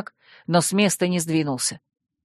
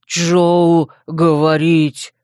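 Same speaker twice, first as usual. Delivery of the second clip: slow, loud brute growl; threatening growl; 4 dB louder, much slower than usual. A narrator's reading. Dep answers Joe's question, threatening growl. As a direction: growl it menacingly, dragging each word. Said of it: "угрожающе прорычал тот".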